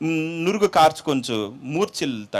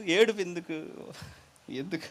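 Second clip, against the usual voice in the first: quieter echo; 7 dB quieter than usual. Shown as a demonstration. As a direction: repeat "ఏడుపు ఎందుకు" softer, trailing away. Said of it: "ఈ ఏడుపు ఎందుకు".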